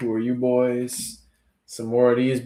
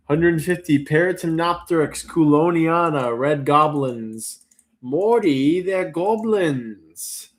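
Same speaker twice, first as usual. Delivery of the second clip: usual speaking voice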